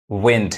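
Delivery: विंड with short 'i', as 'vind'; taught pronunciation. Shown as a demonstration. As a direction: say विंड like the noun 'wind'. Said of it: "वह व होता है". (विंड में छोटी 'इ' बोलें)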